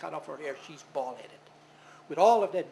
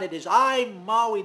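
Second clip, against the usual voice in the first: loud, defiant declaration; proud shout; 4 semitones higher, 9 dB louder, slower than usual. Acted. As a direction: call it up to the sky proudly. Maui shouts up at the sun.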